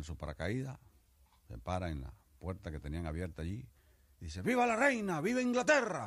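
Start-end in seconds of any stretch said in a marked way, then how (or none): 4.4-6.1 high, loud cheering shout; patriotic cry. Acted. English soldier cheers the queen before jumping: high shout. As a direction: yell it as you leap out the door.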